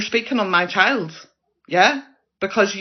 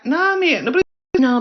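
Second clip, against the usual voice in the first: much higher, sharp "no, mate"; exasperated outburst; 7 semitones higher than usual. Real, not acted. Vlogger shutting down a commenter, exasperated high outburst. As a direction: cut them off in disbelief, pitch shooting up.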